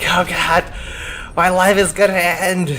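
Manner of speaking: high, hammed-up cry of doom; mock panic. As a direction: wail it in comic despair. Retells being totally freaked out.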